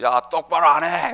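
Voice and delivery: mock scolding yell; retold yell. Preacher re-enacting his morning outburst at home, mock yell. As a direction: imitate yourself hollering at the family. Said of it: "고함을 지르는 거죠".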